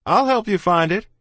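high, loud offer; shouted. A narrator's reading. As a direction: shout it eagerly across the field. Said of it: "he shouted".

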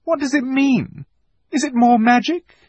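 put-on very high, bewildered girl; pleading for an answer; distressed. A narrator's reading. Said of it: "cried Susan".

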